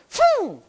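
sudden high blowing whoosh; imitated deer snort. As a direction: blow like a startled deer bolting.

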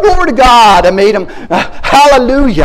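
loud, high preaching exclamations; full-force preacher praise; fervent praise. Real, not acted. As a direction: shout the praise out.

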